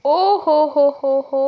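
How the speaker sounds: slow, drawn-out teasing oho; playful gotcha drawl; knowing tease. Real, not acted. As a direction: stretch it out like you caught him. Teasing after learning of a fight.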